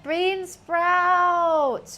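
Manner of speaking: loud, long drawn-out wail of dismay. Real, not acted. Cook finds a missing chow mein ingredient, dismayed wail. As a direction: wail it out, stretching the words.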